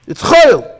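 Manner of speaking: "chayil" high and loud; shouted chayil. Real, not acted. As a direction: cry it out to the room. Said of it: "repeated louder".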